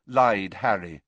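plain reading voice

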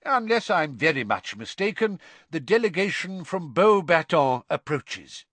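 hearty proclamation; Dumbledore calling out. A narrator's reading. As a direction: call over a crowd from the back. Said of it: "Dumbledore called out from the back row".

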